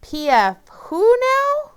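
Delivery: high, loud, slow baffled question; confused, squeaky disbelief; puzzled. Acted.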